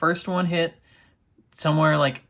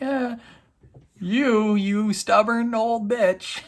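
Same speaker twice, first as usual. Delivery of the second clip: higher and slow, teasing put-on voice; mock Gao banter; 8 semitones higher, slower than usual. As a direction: drag out the insult in a silly voice. Gao bantering insult at Avasarala.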